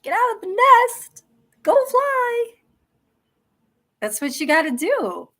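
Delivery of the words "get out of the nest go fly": much higher, louder mommy bird pushing her chicks out; cartoon mother bird calling her chicks out to fly. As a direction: squawk it like a mother bird shooing babies from the nest. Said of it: "uh, mommy bird going".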